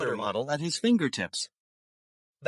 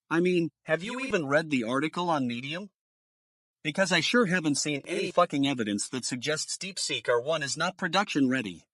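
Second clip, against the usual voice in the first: normal talking voice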